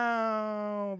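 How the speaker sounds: high, long drawn-out "No"; spoiled girl's wail. Retold or imitated. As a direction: stretch it out like a mock brat. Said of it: "and then she goes".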